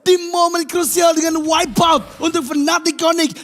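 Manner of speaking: slow, high shouted climax call; hype shout. Acted. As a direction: draw out the big moment. Live teamfight play-by-play.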